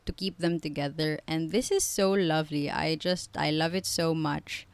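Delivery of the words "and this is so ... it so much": ordinary delivery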